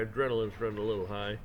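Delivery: usual speaking voice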